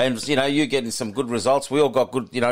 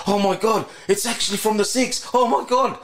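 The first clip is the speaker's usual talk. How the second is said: much higher, thrilled disbelief; quoting a mate thrilled by his win; excited disbelief; 8 semitones higher than usual. Retold or imitated. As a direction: squeal it like you can't believe it. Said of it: "He's like".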